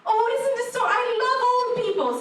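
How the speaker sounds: high, sweet gushing voice; gushing lady. Retold or imitated. Salon worker fawning over old mother, high gush. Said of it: "said this lady".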